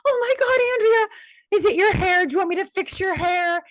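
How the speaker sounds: imitating Sarah in a high, loud, worried voice; worried makeup girl. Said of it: "she goes".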